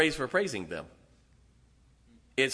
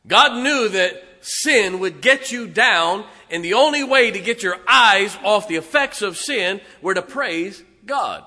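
preaching hard; 4 semitones higher, 6 dB louder than usual. Acted. Preaching at full force.

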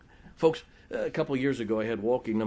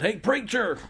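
a high call across a parking lot; holler; 9 semitones higher, 3 dB louder, slower than usual. Retold or imitated. Brother Mike calling the preacher back across the lot, a high holler. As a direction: call out to get the preacher's attention. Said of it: "Brother Mike hollered".

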